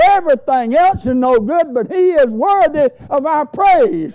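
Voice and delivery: high, ringing pulpit cry; fervent preaching. Real, not acted. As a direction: proclaim it with mounting fervor. Preacher rises to full force.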